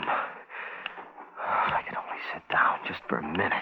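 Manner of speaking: slow, fading dry whisper; exhausted whisper. Acted. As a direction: whisper it slowly, almost giving up. Man alone, exhausted and parched.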